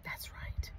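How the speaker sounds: barely audible whispered reply. Real, not acted. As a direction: breathe the answer without voice. Answer to "isn't that right.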